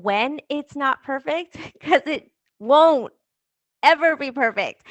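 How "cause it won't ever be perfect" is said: laughing. Real, not acted. Laughing at own perfectionism.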